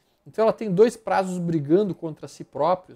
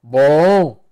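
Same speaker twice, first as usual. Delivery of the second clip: loud approval; 3 semitones lower, 14 dB louder than usual. Real, not acted. Approving a good chart.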